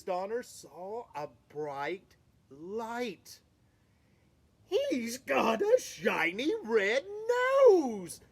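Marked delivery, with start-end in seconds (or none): 4.7-8.2 louder, higher, amazed father reindeer; surprised. Acted. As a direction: gasp it out like you can't believe your eyes. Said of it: "said Donner in surprise".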